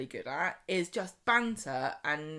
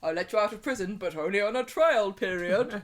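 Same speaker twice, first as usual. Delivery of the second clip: a hint of a stern official, louder; mock stern boss; 4 dB louder than usual. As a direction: warn her like a strict boss, lightly voiced.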